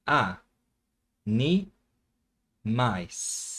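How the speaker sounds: "A, ni, mais" with a pause after each syllable. Shown as a demonstration. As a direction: separate it like a teacher: "A", "ni", "mais". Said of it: "vamos separar".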